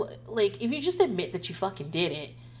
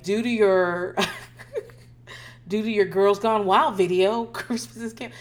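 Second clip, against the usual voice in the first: laughing through it; 2 semitones higher, 5 dB louder than usual. Retold or imitated. Mock announcement to twerking kids.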